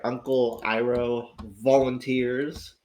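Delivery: normal talking voice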